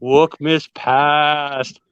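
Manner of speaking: slow, drawn-out spooky ghost voice. Acted. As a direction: moan it out like a haunting ghost. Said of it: "I'm trying out my ghost voice".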